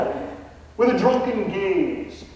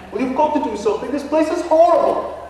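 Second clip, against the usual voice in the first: put-on a Texan faking a posh English accent, pitched higher; hammed-up English gentleman ghost; mock English accent; 9 semitones higher, 4 dB louder than usual. Ghost Nigel answers the realtor in a fake English accent. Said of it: "a Texan trying to emulate an Englishman".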